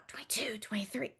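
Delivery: soft whispered counting. Retold or imitated. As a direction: whisper the numbers under breath. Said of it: "we whisper".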